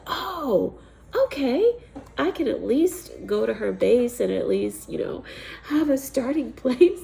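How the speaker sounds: like a lightbulb going on; relieved excitement. Retold or imitated. Remembering a place to start, bursting with relief.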